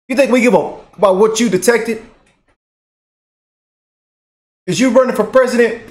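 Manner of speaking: high, heated challenge; indignant anger. Real, not acted. Co-host challenging the moderator, indignant anger. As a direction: demand it like you're squaring up to him. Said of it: "I done got mad".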